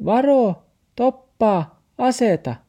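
very high squeaky yell; dog's shouted warning. A narrator's reading. Dog shouts at Max to watch out, high-pitched yelling. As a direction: cry out urgent commands in a high dog voice. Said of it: "Taksi hyppäsi ulos kaapista ja huusi".

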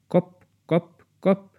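high tapping knocks; knocking sound. Acted. Knocking heard from below, high tapping. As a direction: tap it out from under the floor.

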